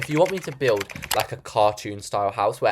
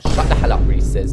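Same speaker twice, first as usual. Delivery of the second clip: playful snap at herself to stop moaning; a mock telling-off aimed at herself; mock self-scolding; 3 semitones lower, 7 dB louder than usual. Real, not acted. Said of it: "What am I even moaning about?".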